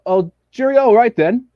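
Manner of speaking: a quick, hammed-up fake English accent. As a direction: toss off a stock English phrase in a put-on English accent. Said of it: "Can you fake an English accent?".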